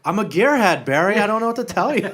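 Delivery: high, loud camp henchman; hammed-up lilting excuse, near laughter; Tommy Wiseau-like accent. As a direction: protest breezily in a put-on foreign accent. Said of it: "kinda sound like Tommy Wiseau".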